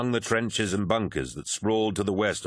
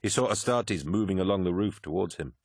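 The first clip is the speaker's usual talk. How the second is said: plain reading voice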